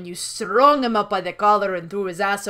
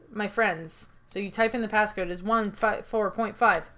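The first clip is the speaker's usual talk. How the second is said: ordinary delivery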